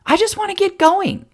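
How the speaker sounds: put-on high, whiny kid voice; itching to leave; boy eager to go see horses; impatient boy. A narrator's reading.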